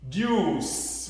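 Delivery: usual speaking voice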